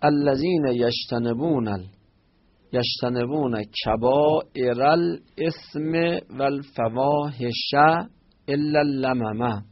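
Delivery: slowly, word by word, spelling out the verse; teacher repeating the verse slowly for the class; slow teaching repeat. Shown as a demonstration. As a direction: drag each word out so students can follow. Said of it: "slow repeat for students".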